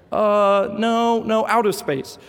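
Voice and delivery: put-on high, dismissive little boy; little boy correcting. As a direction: brush off dad's answer like a four-year-old. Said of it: "And he's like".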